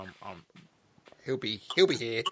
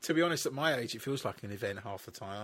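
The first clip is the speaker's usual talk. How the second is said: normal talking voice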